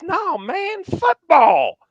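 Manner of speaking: put-on high, eager correction of the new kid; excited teammate. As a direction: set him straight with enthusiasm.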